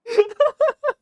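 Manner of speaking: loud, high squeal of a laugh; high burst of laughter. Real, not acted. Laughing hard to chat about the Marathon prank.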